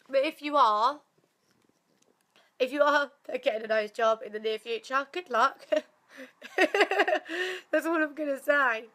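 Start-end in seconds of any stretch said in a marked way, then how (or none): 5.7-8.8 slow, laughing tease. Real, not acted. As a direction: laugh through the warning. Laughing warning.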